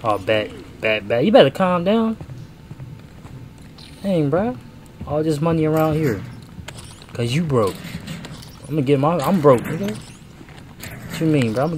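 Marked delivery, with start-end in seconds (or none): none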